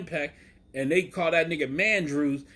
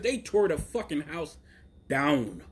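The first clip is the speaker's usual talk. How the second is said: ordinary delivery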